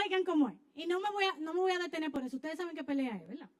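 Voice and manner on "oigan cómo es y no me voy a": ordinary talk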